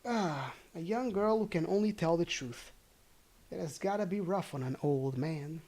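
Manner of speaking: ordinary delivery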